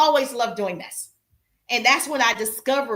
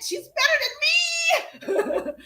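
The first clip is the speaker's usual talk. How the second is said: high, drawn-out mock crying, laughing; mock wail; much slower than usual. Speaker acting out envy of a better colleague, mock wail. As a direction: wail it in comic despair, stretched out high.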